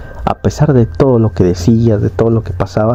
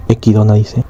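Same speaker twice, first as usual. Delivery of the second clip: plain reading voice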